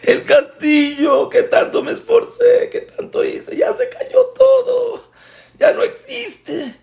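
high, sobbing lament; crying. Acted. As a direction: wail over the lost castle, voice pitched high. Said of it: "empieza a llorar".

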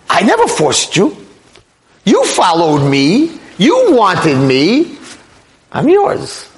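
high-pitched mock accusation, drawn out on each "you"; accusing. Satan to the sinner he trapped, accusing. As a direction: throw the blame back, point by point. Satan throws blame back, acted.

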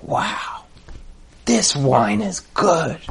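hushed, drawn-out appreciation; soft and slow, relishing every word; savouring wonder. Retold or imitated. Rebbe savours wine at table.

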